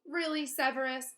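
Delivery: teacher sharply rebuking a colleague; sharp retort. Acted. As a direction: challenge him sharply. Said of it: "said Professor McGonagall sharply".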